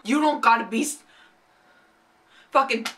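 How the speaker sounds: ordinary talk, nothing marked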